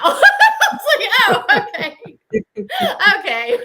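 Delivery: laughing through the words, high; real laugh breaking into the line. Real, not acted. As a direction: giggle out an embarrassed okay. Laughing at her own naivety.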